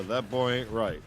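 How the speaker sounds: mild Hank Hill drawl demo; Hank Hill line. Shown as a demonstration. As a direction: model the Hank line for him. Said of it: "Do a Hank".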